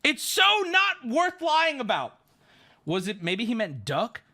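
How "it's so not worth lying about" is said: high, slow, hammered protest; exasperated insistence. Real, not acted. Storyteller denying the story is made up, exasperated. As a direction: stretch each word in protest.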